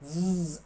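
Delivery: ज़ as a long sustained zzz; buzzing z sound. Shown as a demonstration. Teacher showing tongue on teeth, buzzing ज़ sound. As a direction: hold ज़ as a tongue-on-teeth buzz. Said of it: "ऐसा sound आता है".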